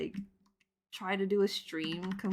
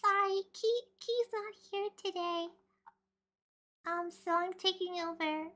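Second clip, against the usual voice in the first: much higher cutesy anime voice; moe voice; 11 semitones higher than usual. Pretend. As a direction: play a sweet stand-in host. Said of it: "April Fool's Moe voice stream".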